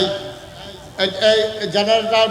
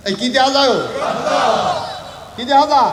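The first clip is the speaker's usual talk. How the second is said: raised, higher call to the crowd; loud prompting; 7 semitones higher, 6 dB louder than usual. Real, not acted. Preacher prodding the crowd to answer, loud prompting. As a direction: demand the answer from a big congregation.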